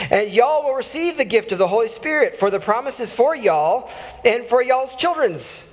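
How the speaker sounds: a light, playful Southern drawl on every y'all. Shown as a demonstration. Pastor rereading Acts to his congregation in a hint of Southern drawl. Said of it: "I'd like to read it in the southern drawl".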